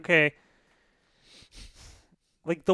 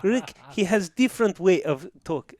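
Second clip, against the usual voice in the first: higher mock Russian coach; bad Russian accent; 5 semitones higher than usual. Coach explaining Rick to a player, mock Russian accent. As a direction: excuse a colleague in broken, Russian-accented English. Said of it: "the worst Russian accent".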